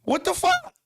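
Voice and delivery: high-pitched incredulous outburst; real disbelief, voice shooting way up; squeaky what-the-fuck exasperation; exasperated disbelief. Real, not acted. Exasperated at blame from fans.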